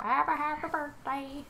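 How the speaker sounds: silly voice. Acted. Random silly sing-song line.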